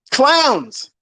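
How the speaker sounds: sudden mocking shout; scornful yell. Real, not acted. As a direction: hurl the word at them with contempt. Outburst at critics.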